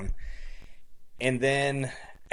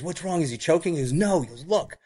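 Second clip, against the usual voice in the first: urgent, alarmed; 6 semitones higher than usual. Retold or imitated. Doctor and nurse urgent.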